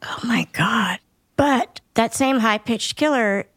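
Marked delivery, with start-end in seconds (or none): 0.0-1.0 breathless whisper of disbelief; stunned whisper at a grim story; whispered shock. Real, not acted. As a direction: gasp it out under your breath. Shocked reaction to murder call.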